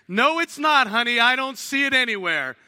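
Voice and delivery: mock indignant husband. Arguing with wife at fridge.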